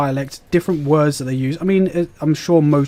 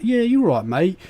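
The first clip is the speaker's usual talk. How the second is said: higher, hammed-up cockney geezer voice; cockney accent; 6 semitones higher than usual. Streamer offers chat a cockney accent, hammed-up cockney. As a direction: lay on a cheeky cockney accent. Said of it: "I can go a bit cockney".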